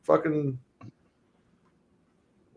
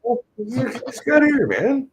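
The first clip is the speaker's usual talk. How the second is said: mock outrage; 13 semitones higher, 4 dB louder, slower than usual. Real, not acted.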